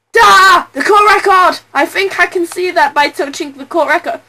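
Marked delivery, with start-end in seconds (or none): 0.1-1.6 loud, triumphant exclamation; loud eureka shout; excited shout. Acted. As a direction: announce the find with a burst.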